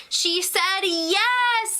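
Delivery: slow, high gush of delight; excited. Real, not acted. Fan announcing the accepted proposal, drawn-out high excitement.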